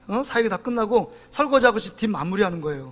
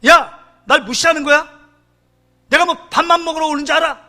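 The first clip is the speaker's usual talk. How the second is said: retold indignant outburst, pitched up; mock-angry confrontation, raised pitch; 6 semitones higher, 4 dB louder than usual. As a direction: lash out like an offended man, higher voice. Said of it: "대짜고짜 화를 내면서".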